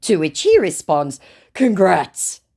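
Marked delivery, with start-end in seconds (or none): 1.5-2.4 dry mock congratulations; sarcastic. Scammer mocking her with sarcastic congrats. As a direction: fake praise with a sneer. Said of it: "Very sarcastic, clapping".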